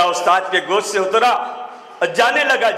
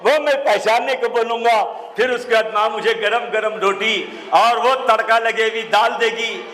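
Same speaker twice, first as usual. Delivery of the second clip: usual speaking voice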